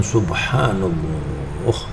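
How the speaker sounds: very slow, soft, rising "Subhanallah" full of wonder; a believer marvelling at creation; with understanding. Shown as a demonstration. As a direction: draw out "Subhanallah" as if truly amazed. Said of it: "Tapi kalau orang itu mengerti".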